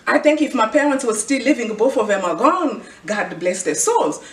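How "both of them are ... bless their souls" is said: normal talking voice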